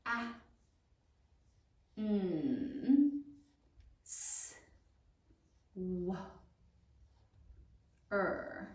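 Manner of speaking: "A, n, s, w, er" drawn out with long pauses; saying while writing. Shown as a demonstration. Teacher dictates answer as students write, sound by sound. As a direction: hold each sound in "A, n, s, w, er" at writing speed. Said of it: "Now say it as you write it".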